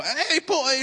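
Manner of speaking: put-on a light British accent, pitched high; little British accent. Dad playfully mimicking Harry Potter's British accent. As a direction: toss off a quick mock British accent. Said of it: "the little accent".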